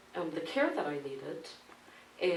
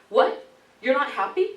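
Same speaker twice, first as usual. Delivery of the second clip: high, loud, incredulous mock doctor; rising mock indignation; indignant doctor; 8 semitones higher, 10 dB louder than usual. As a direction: mimic the doctor's outraged disbelief. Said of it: "the doctor pops in ... and says".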